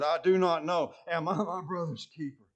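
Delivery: contemptuous answer. Retold or imitated. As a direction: throw the question back at God in scorn.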